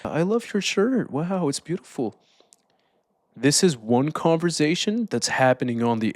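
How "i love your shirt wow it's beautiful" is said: high, bright friendly small talk; sweet, high chatty voice; kind friendly greeting. Shown as a demonstration. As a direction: greet him like an eager nice guy. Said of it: "you can go talk to a, another person in a kind way".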